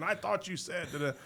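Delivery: high-pitched retold protest; mock yelling. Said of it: "starts yelling at the old man".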